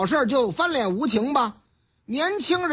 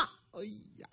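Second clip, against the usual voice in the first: ordinary talk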